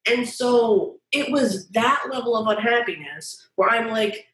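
normal talking voice

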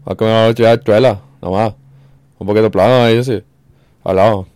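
loud, run-together mock Dominican accent; mock Caribbean Spanish. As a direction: mumble it loud like Caribbean slang. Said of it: "talking like [name]".

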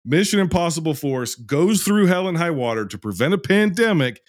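high, forceful, punched-up declaiming; emphatic rant. Real, not acted. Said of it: "Let me say it again with emphasis".

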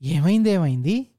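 sudden worried repeat of the question; a boss suddenly worried about an injured child; anxious alarm. A narrator's reading. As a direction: blurt it out, alarmed for the boy. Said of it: "ఆవేదన మొహంలో కనబడింది".

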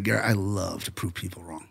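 low, quiet quote; James Cameron. Retold or imitated. Host quotes the director who loves proving people wrong, low and quiet. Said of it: "He's like".

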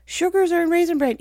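imitating a scandalised audience; mock shocked viewer. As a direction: gasp at her like a shocked viewer. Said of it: "some of you just went".

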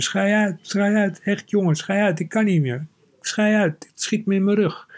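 high, breathless father begging through laughter; laughing father. Retold or imitated. Kees imitating his father crying with laughter, high and helpless. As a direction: squeal it like a man who can't stop laughing. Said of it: "riep mijn vader".

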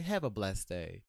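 low, slow syrupy farewell; slow, controlled sweet dismissal; smiling passive aggression. Shown as a demonstration. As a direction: draw it out low through a smile. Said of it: "like, with a smile".

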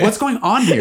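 high, laughing disbelief; amused surprise. Retold or imitated. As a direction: ask it half laughing, baffled. Friends see Kinetics crying.

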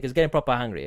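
ordinary talk

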